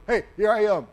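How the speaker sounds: eager answer. Retold or imitated. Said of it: "and he goes".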